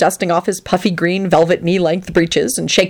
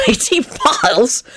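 high, mirthful disbelief; choked out; 6 semitones higher than usual. Acted. Lupin overcome with mirth at the distance, choked high. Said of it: "Lupin choked out".

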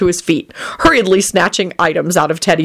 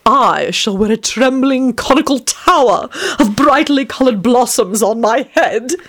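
rapturous vampire; 6 semitones higher than usual. A narrator's reading. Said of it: "he informed Elizabeth rapturously".

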